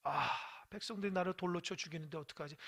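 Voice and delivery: low, soft fretting to himself; worried deliberation. Acted. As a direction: fret under your breath, unsure what to do. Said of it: "고민합니다".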